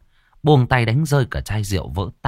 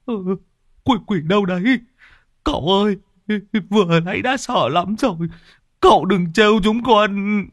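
very high, whimpering plea; cowardly high-pitched wail; scared clinging henchman; 12 semitones higher than usual. A narrator's reading. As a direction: whine in fright, begging the master. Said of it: "Lâm Sẹo cũng bám chặt".

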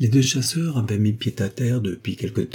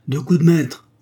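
impressed exclamation; 7 semitones higher, 6 dB louder than usual. A narrator's reading. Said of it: "dit monsieur de Lavernay".

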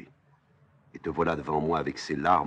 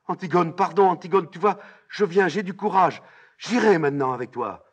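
high, loud, urgent plea; desperate pleading; 10 dB louder than usual. Acted. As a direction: beg your sister at full voice. Ismène supplie sa sœur. (supplication criée, éperdue)

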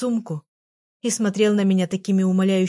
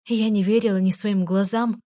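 plain reading voice

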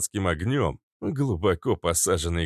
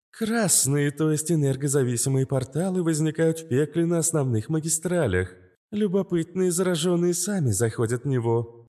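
higher, even machine-like AI; calm AI voice; 10 semitones higher than usual. A narrator's reading. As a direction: explain it like a calm AI. Narrator voices AI Astra.

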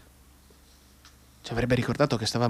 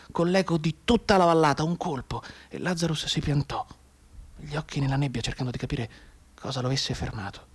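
usual speaking voice

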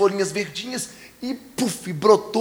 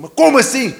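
indignant demand; 8 semitones higher, 10 dB louder than usual. Retold or imitated. Neighbor demands explanation.

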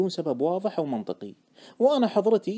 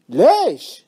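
loud, raised one-word question; astonished, excited; 5 semitones higher, 10 dB louder, much slower than usual. Acted. Friend asking the trader for a reason, excitedly astonished. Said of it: "سألني باستغراب ممزوج بحماس".

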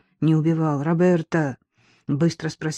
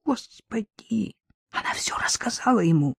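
horrified whisper; 4 semitones higher, 4 dB quieter than usual. Acted. Roberta in terror, whispering. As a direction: breathe it out in horror.

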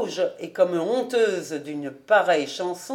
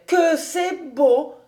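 higher, slow, wondering sigh; admiring girl; 7 semitones higher, 3 dB louder, slower than usual. A narrator's reading. Suzel marvelling at Fritz's waltz, higher and slow. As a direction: breathe it out in awe. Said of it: "dit-elle".

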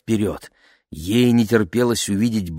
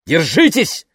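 calling out; 9 semitones higher, 5 dB louder than usual. A narrator's reading. Father warns passengers, turning.

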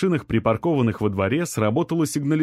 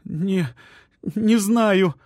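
high, tearful broken answer; trapped woman in labour answering through sobs; sobbing woman; 9 semitones higher, much slower than usual. A narrator's reading. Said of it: "всхлипнула она".

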